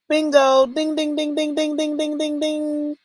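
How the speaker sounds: high, rapid chanted dings; mock winner's bell. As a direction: ring out like a quiz-show bell. Imitates winner's bell in quiz.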